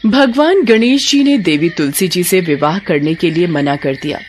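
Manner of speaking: ordinary delivery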